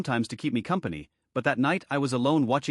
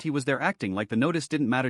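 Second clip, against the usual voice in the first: ordinary delivery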